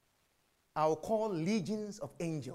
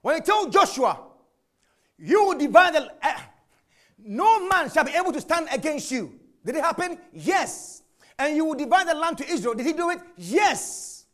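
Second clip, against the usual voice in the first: high, loud preaching; full-force preaching; 8 semitones higher, 9 dB louder than usual. Acted. Preacher to congregation, fervent loud preaching. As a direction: build the fire and preach.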